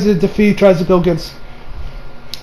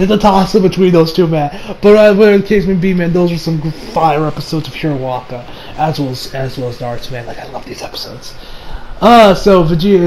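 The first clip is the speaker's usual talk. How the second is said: normal talking voice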